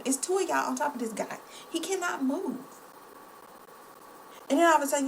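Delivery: normal talking voice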